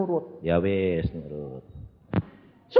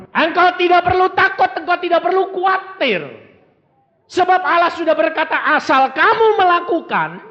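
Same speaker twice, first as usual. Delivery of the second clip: loud, high reassuring sermon cry; rousing pulpit proclamation, much louder and higher; full-force preaching; 5 dB louder than usual. Acted. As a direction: proclaim it boldly to the church.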